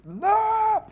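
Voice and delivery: very high, loud drawn-out wail; screamed no. Real, not acted. Animator's selection fails a second time, frustrated scream. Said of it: "all the times that I scream".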